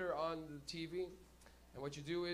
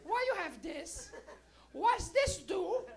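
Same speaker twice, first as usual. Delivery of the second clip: high, slow, puzzled parent voice; suspicious parent; 9 semitones higher, slower than usual. Retold or imitated. Parent finding a grinder, demanding answers in a high slow voice. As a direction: interrogate like a confused mom. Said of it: "And they go".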